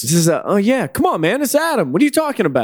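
high-pitched, insistent protest; playful phone pretence. Pretend. Answering a doubting caller as Adam, mock indignant. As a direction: play a caller insisting it really is you.

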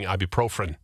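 low "ibuprofen" as eye-byoo-PRO-frend; extra-R ibuprofen. Shown as a demonstration. Host insisting on spelling, "ibuprofen" as low PRO-fren. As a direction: slip an R into "ibuprofen". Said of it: "look at the spelling".

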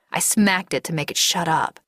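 usual speaking voice

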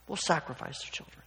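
sarcasm dropped to a hushed voice; sarcastic. Acted. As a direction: finish the absurd offer softly. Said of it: "you can hear the sarcasm".

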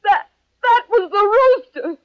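high, stammering protest; wounded outburst. Acted. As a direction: blurt it out, close to tears. Hurt actress mistaken praise, upset.

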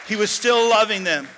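loud cry with the weight on still; preaching shout. Real, not acted. Preacher repeating his point to the church, loud cry. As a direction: hammer the repeat home.

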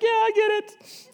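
high, loud, drawn-out squeak as a woman; mock tearful Mary. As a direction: agree in a hammed-up falsetto. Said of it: "She's like".

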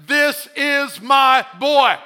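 proud proclaiming shout. Acted. Voicing God the Father to the church, proud slow shout.

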